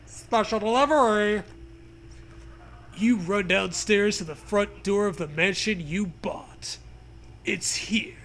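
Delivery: ordinary delivery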